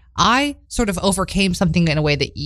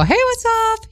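cheery hello; slower than usual. Retold or imitated. Greeting date in the rain.

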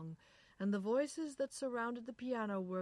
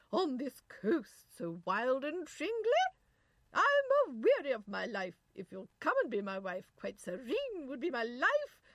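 put-on English nurse accent; 7 semitones higher, 9 dB louder than usual. A narrator's reading. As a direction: declaim in grand English tones. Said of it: "the nurse went on".

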